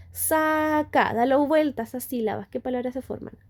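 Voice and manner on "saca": saca said slowly, each syllable clear; slow demo word. Shown as a demonstration. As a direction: spell out saca slowly for the child. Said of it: "Yo digo".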